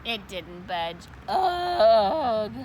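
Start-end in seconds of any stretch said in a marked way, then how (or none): 1.3-2.5 loud straining grunt; effort grunt. Acted. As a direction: grunt as if lifting something huge. Straining to lift.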